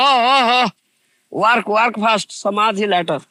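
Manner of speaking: mock exclaiming, pushy and loud; mocking imitation. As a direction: mimic them derisively, loud and high. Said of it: "all say".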